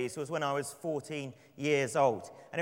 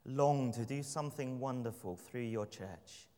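soft, low prayer voice, unhurried; 5 semitones lower, 5 dB quieter than usual. Acted. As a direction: pray gently and reverently, softer and lower. Opening prayer before the sermon.

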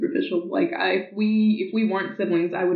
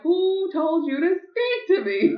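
high, slow haughty brush-off; snooty put-down; 10 semitones higher, slower than usual. Retold or imitated. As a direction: look down on him, slow and high. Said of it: "And I'm like".

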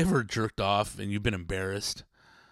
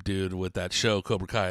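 ordinary talk

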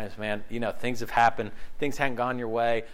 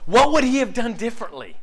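preaching emphasis; 7 semitones higher, 9 dB louder than usual. Real, not acted. Rhetorical question, preached hard.

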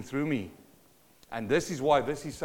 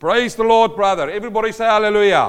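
loud, raised-pitch praise; rousing call-out; 5 semitones higher, 12 dB louder, faster than usual. Real, not acted. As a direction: call on the congregation to shout back. Preacher rousing congregation to respond.

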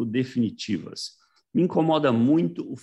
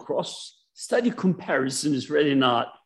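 lightly voiced Australian lecturer; Australian accent; 4 semitones higher than usual. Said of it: "aquele sotaque australiano".

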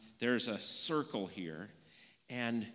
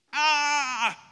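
loud high hammed-up ah; play-acted shriek for the children; mock pain cry; 14 dB louder than usual. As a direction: yelp as if a toe got stepped on. Acted toe-stepping skit.

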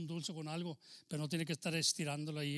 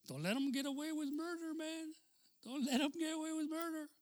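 high emphatic warning; 11 semitones higher than usual. Real, not acted. Preacher pressing a point.

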